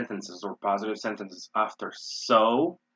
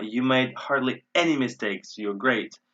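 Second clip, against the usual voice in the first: ordinary delivery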